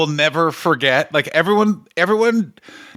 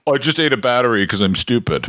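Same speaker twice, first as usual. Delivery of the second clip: Dylan Gott impression; 6 semitones lower than usual. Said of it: "Here's another Dylan Gott impression".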